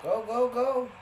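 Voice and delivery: high, loud frantic cheering; panicking gamer. Real, not acted. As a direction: urge the runner on in a frenzy. Runner breaks free in game.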